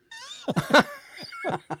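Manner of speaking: high-pitched laughter; helpless high laugh at a joke. Real, not acted. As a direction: burst into high giggles. Laughing at his own joke.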